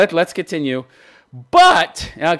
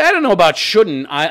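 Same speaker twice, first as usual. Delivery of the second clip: ordinary delivery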